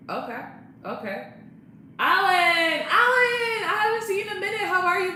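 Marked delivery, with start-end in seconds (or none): 2.0-5.1 high, loud, thrilled call to a friend; light up and shout his name; overjoyed hello to a friend; excited greeting. Real, not acted.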